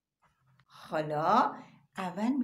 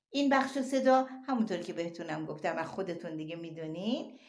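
usual speaking voice

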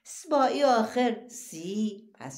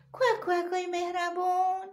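bright high sing-song call, much higher; cheerful call; 9 semitones higher than usual. Acted. Teacher introduces the friendly character to children, higher sing-song call. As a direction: announce the kind character with delight.

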